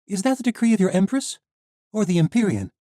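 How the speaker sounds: put-on high, loud young heroine; defiant young woman. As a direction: stand up to the guard. Misha challenges the knight.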